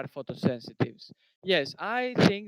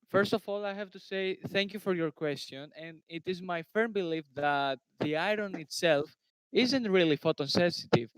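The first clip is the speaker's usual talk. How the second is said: raised, projected voice; firm, louder answer with punched words; speaking louder; 5 dB louder than usual. Real, not acted. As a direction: speak up so they can hear you. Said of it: "speak louder".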